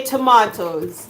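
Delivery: "tomatoes" said tuh-MAH-toes; British tomatoes. Shown as a demonstration. Telling viewers the UK form, "tomatoes" tuh-MAH-toes. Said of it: "in the U K, we say".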